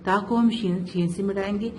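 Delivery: usual speaking voice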